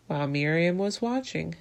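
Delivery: usual speaking voice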